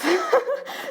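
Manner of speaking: high-pitched burst of laughter; spontaneous high giggle. Real, not acted. Laughs at her own excitement.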